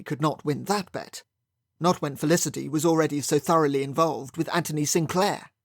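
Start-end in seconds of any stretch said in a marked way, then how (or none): none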